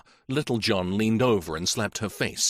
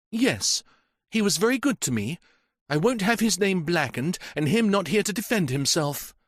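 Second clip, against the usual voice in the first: higher, earnest and tearful in tone; overwrought young woman; 9 semitones higher than usual. Acted. Janet to the inspector, protective and upset. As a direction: hold back tears while insisting.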